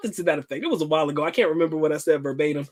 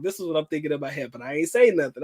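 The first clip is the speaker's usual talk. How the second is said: normal talking voice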